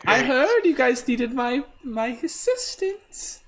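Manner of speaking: high, slow, grand lady; theatrical diva voice. Acted. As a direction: sweep in like a glamorous diva.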